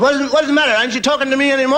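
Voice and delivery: high, fast feigned innocence; high innocent husband. Acted. Husband caught in bed pretending nothing's wrong, high innocent.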